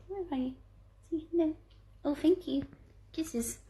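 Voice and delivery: soft, high cooing to a pet; doting dog talk. Real, not acted. Presenter finding her dog Magic, soft doting baby talk. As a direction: coo gently, asking a pet for kisses.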